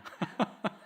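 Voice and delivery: high, brief chuckle; short tittering afterlaugh; short amused laugh. Real, not acted. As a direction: let out a quick high laugh.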